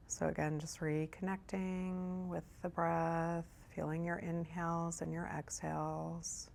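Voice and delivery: soft, hushed meditation guide with long pauses; guided meditation. Real, not acted. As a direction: ease them gently into breath and body, softly. Leads a meditation.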